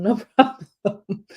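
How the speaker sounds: slow, laughing through no problem; laughing mock reply. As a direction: chuckle as you drag it out. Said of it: "he's like".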